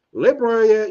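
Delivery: loud call to the guest. Real, not acted. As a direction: call his name out loud. Host calling on the guest.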